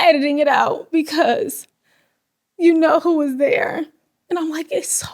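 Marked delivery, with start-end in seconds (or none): 4.7-5.1 breathless whisper through tears; tearful whisper. Real, not acted. Telling listeners her faith, in a tearful whisper. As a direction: whisper it, overcome.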